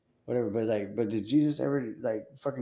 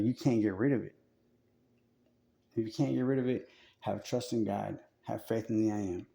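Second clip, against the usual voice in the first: normal talking voice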